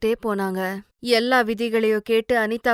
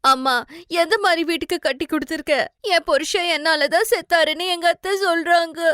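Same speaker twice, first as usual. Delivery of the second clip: crying; 7 semitones higher than usual. Acted. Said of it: "Anitha அழுதுகிட்டே அம்மா கிட்ட சொன்னா".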